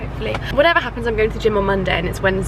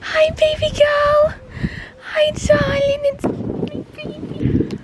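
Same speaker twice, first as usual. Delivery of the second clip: much higher, slow cooing; 3 dB louder, much slower than usual. Real, not acted. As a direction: melt over the mare. Greeting her mare.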